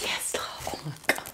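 breathless whisper of delight. Real, not acted. Unpacking her takeout for viewers, delighted whisper. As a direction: whisper it to yourself over the food. Savoring the food arrival.

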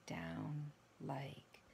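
soft, slow near-whisper. Shown as a demonstration. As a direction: ease right down into a hush. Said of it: "slow my talking down like this".

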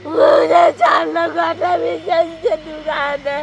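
drawn-out tearful cry. Real, not acted. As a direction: wail out the complaint through sobs. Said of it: "আপনি কাঁদতেছেন কেন?".

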